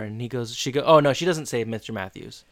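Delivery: ordinary talk, nothing marked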